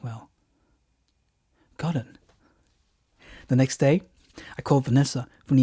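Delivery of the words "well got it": ordinary delivery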